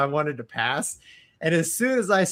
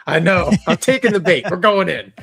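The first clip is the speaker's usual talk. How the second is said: excited; 4 semitones higher, 8 dB louder than usual. Real, not acted. Gleeful eager charging in.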